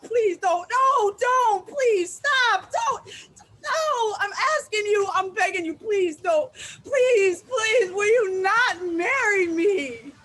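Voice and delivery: begging, building to a near-sobbing cry; desperate pleading. Acted. To the partner about to propose, frantic begging.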